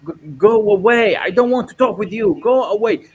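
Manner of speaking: woman shouting at the filmmaker to leave; angry shout. Retold or imitated. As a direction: yell at him to go away. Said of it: "she was told me".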